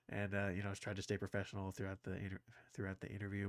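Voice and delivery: normal talking voice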